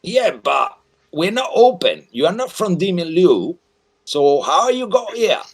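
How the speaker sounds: high, loud barker's call; loud carnival barker. Acted. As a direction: bellow it like a carnival barker working the crowd. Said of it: "That's why I speak so loud".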